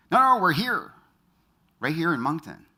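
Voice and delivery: high, loud, hurried-in correction; eager insistence. Retold or imitated. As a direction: imitate a caller eagerly insisting. Retold caller insisting he's local.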